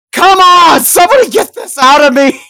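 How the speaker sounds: very loud, high-pitched desperate scream; pained labour yell. Acted. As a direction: yell through overwhelming labour pains. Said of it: "the pain overwhelms her".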